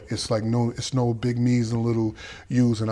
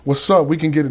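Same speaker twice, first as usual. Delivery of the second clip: defiant; 5 semitones higher, 6 dB louder than usual. Retold or imitated. Defiant standoff with older inmates.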